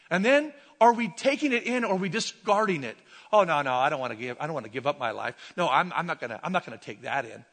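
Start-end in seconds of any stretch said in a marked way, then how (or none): 3.3-7.5 low, grumbling refusal; lightly acted low-voiced objection; mock reluctance. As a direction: act out someone digging their heels in.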